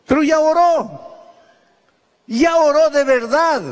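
ringing pulpit emphasis; emphatic proclamation. Real, not acted. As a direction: declare it with full conviction. Preacher proclaims sincere prayer.

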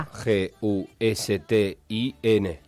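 letters spelled out slowly one by one; slow spelling. Shown as a demonstration. Radio host spelling the name out slowly for the contest. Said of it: "Empezás".

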